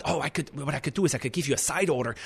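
a hint of a nervous waitress, softly hurried; timid server. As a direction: hush it like a scared waitress dodging the kitchen. Server's timid excuse retold.

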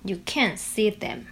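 slow careful can't with stress; slow practice repeat. Shown as a demonstration. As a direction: repeat slowly, leaning on can't. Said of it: "那你再教我们讲一次".

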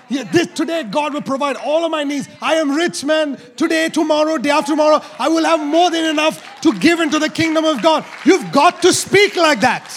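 loud, rising preacher's proclamation; full-force preaching. Acted.

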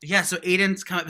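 ordinary talk, nothing marked

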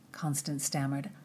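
plain reading voice

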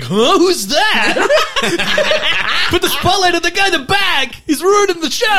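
high, loud, laughing stagehand voice; spotlight guy voice. Retold or imitated. Spotlight operator spotting the man in back, high laughing voice. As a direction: play a flustered tech guy barking orders. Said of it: "the guy who's manning the spotlight is like".

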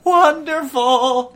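very high, loud, drawn-out cry; overjoyed old man. Acted. Old man greeting his new helpers, overjoyed high cry. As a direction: stretch it out in high delight.

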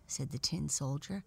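usual speaking voice

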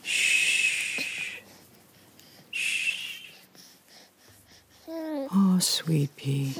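put-on whispered hush to a baby; shushing whisper. Hushing baby to sleep.

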